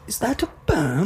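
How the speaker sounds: high-pitched mock English accent; bad English accent. Shown as a demonstration. Coaching his co-host's accent, high English accent. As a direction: put on a fake English question. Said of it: "you have to start it off with".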